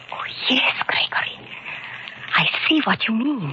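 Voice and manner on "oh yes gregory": breathless awed whisper; whispered realisation to her husband. Acted. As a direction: barely voice it, stunned by the find. Hushed discovery at hiding place.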